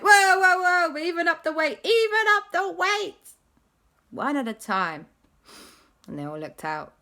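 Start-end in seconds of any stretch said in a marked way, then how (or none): 0.0-5.1 loud high panicky whoa; steady the tilting plane, alarmed. Acted. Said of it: "everyone went".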